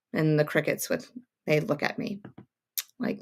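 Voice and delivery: ordinary talk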